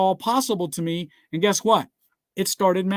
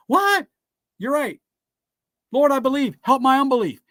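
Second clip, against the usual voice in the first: high startled what, then raised earnest plea; startled pleading man; 5 semitones higher, 3 dB louder than usual. Retold or imitated. The father answering Jesus, startled then pleading. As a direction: voice the man caught out, then begging.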